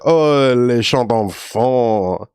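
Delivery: very slow, stretched Creole endearment; Creole accent. Retold or imitated. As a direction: coo it like a Louisiana boss pitying you. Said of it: "a really Creole way of calling somebody".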